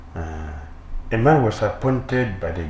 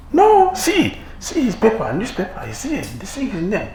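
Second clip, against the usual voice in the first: much higher, eager insisting; insistent friend; 10 semitones higher than usual. Acted. Friend urges the envious man to see the paper, high and eager. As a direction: push the newspaper at him.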